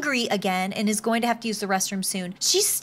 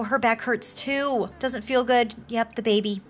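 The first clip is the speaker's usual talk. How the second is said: ordinary delivery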